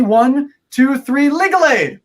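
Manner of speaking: rising excited countdown, shouted finale; kids party magician. Acted. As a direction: build up and call out the magic word. Countdown chant of magic word.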